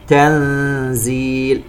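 slow chanted "تنزيل" with hummed n before z; ikhfa recitation. Shown as a demonstration. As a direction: hum the n and stretch the ee of "تنزيل". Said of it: "نون ساكنة وبعدها حرف الزاي إخفاء". (ترتيل بإخفاء نون "تنزيل")

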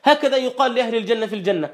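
ordinary talk, nothing marked

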